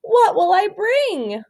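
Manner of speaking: put-on high, slow fretful little girl; a small girl fretting aloud; worried girl voice. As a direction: let her voice climb with worry. Jessica voiced fretting in story.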